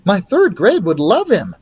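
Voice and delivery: put-on sweetly pleading young teacher. Teacher voice continues.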